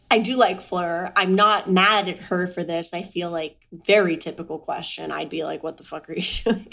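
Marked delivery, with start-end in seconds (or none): 5.6-6.7 fast, exasperated what-are-you-doing; mock indignant outburst. To Fleur, a fast, mock-outraged blurt. Acting herself confronting Fleur.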